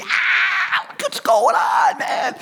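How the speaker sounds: high, squealing celebratory shout; crazy excited yell. Acted. Preacher acting out wild celebration to the congregation, crazy excited yell. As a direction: go skyrocket crazy, pitch way up. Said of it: "Are you going s-, skyrocket crazy?".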